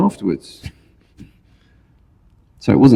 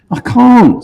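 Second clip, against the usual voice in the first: snooty poodle voice; 13 semitones higher, 4 dB louder than usual. Acted. Poodle answers Labrador.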